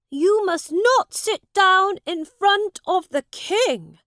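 put-on high, loud young prince voice; a boy king scolding his subject; haughty boy king. A narrator's reading. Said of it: "said Edward".

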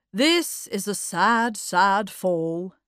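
slow, loud declamation; mock-solemn quoting man. Mug reciting before the baby's perambulator, slow and declamatory. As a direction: declaim it with drawn-out sads.

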